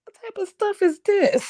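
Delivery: high, put-upon mock outrage; mother calling out kids whispering about her; indignant mama. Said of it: "I'm like".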